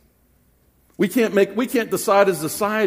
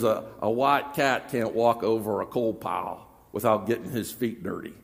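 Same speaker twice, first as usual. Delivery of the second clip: a hint of a Southern preacher's accent, low and soft; 6 semitones lower, 6 dB quieter than usual. Preacher quoting J. Vernon McGee in a Southern accent. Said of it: "a little bit of a southern accent".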